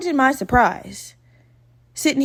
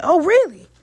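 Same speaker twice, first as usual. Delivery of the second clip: higher, louder imitated surprise; mock surprise; 7 semitones higher, 6 dB louder than usual. Man learning she is twenty-six, imitated surprise. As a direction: play up his surprise.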